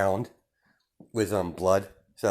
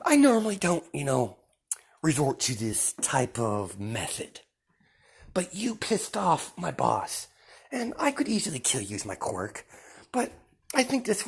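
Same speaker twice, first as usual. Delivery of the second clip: menacing villain Deku; 6 semitones higher than usual. Acted. Deku telling the villains they angered his boss, high and taunting. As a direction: lord it over them, savouring each threat.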